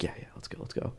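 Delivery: hushed, urgent agreement; barely voiced, conspiratorial reply; furtive whisper. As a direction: whisper it so nobody notices. Furtive agreement beside a cop.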